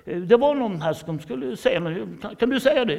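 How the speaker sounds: the boss reluctantly giving you the floor, high and loud; hammed-up boss. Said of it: "säger personalchefen". (chefen ger ordet, högt och ljust)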